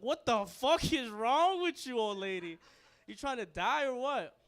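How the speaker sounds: loud, mock-angry scolding; imitating pissed brother. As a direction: chew her out in comic outrage. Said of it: "my brother was pissed".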